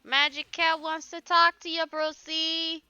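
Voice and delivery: high, loud put-on cat voice; Magic Cat voice. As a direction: play a silly magical cat calling to Brucey. Voicing a magic cat character.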